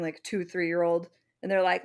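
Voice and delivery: ordinary talk, nothing marked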